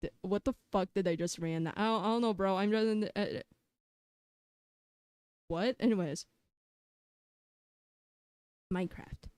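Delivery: ordinary talk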